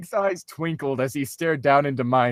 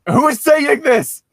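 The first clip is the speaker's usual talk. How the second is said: confused out of character; 14 semitones higher, 7 dB louder, slower than usual. Real, not acted. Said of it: "breaks character asking".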